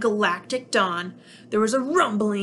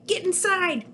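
much higher urgent alien; crying out; 9 semitones higher than usual. Acted. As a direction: hurry them in. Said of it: "cried Nick".

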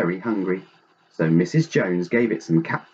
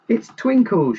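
high, delighted storybook cry; excited shout; 12 semitones higher, 4 dB louder than usual. A narrator's reading. All the Joneses spot their cat, excited shout. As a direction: call out high, as if spotting a lost pet. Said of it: "shouted all the Joneses".

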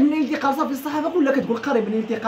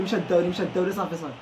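fading despair; 6 semitones lower, 4 dB quieter than usual. Real, not acted. Losing the title.

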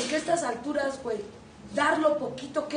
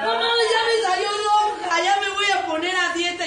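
loud dismay; 5 semitones higher, 8 dB louder than usual. Acted. Acted complaint at mirror.

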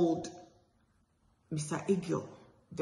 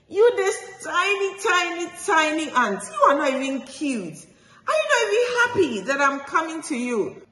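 much higher, louder taunting tiger voice; insulting tiger; 9 semitones higher, 4 dB louder, faster than usual. A narrator's reading. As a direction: taunt the tiny ant from above. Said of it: "Guess what Tiger said?".

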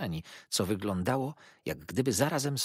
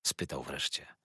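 ordinary delivery

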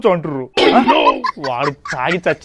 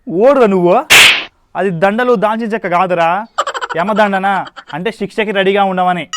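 normal talking voice

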